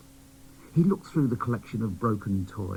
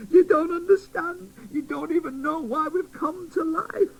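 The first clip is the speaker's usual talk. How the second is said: much higher, fretful fairy voice; fairy scolding the new doll, high; tattered fairy; 4 dB louder than usual. Acted. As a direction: worry at him. Fairy upset.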